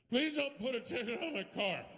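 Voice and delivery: high, pleading plea to a cop. Shown as a demonstration. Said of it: "Here's my attempt to talk my way out of a ticket".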